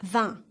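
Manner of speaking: "vingt" as a short nasal van, g and t silent; careful short "vingt" for repetition; model French pronunciation. Shown as a demonstration. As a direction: say "vingt" with a nasal vowel, no final consonant. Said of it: "Say it like".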